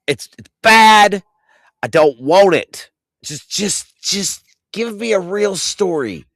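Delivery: high, loud, slowed anguished whine; over-the-top dismay. Real, not acted. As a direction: wail about it like it personally wounded you. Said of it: "acting a little bit over the top".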